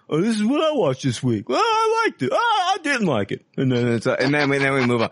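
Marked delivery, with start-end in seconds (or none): 0.1-3.4 high mocking sing-song. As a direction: mimic a bored reviewer, much higher. Mocking their own podcast reviews.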